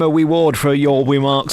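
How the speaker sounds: "reward" as "weward" and REMARKS as "wemarks"; mock speech impediment. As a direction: jokingly turn each R into a W in REMARKS. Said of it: "did a Jonathan Ross thing".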